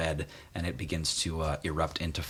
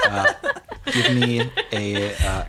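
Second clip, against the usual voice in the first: ordinary talk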